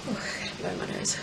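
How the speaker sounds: low soft mutter; quiet aside. Real, not acted. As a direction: drop it to a murmur. Needs nose blow, aside.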